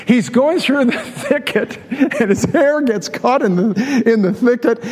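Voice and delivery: slow, amused, a laugh breaking in; barely holding back a laugh at the hair story; laughing through it. Real, not acted. Preacher can't help laughing telling the congregation of Absalom's hair. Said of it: "I'm gonna laugh when I tell this story".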